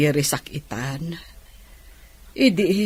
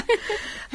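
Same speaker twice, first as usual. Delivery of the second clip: laughing; 6 dB quieter than usual. Acted. Laughs while speaking proudly.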